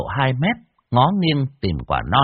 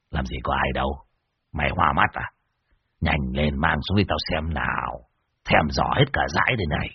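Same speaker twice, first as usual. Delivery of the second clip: much lower, softer character voice; dismissive Muôn Phọt; 8 semitones lower, 4 dB quieter than usual. Acted. Master scoffs at his companion and wants the fruit, dismissive. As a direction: brush off the warning in a low, quiet voice.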